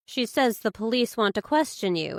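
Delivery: plain reading voice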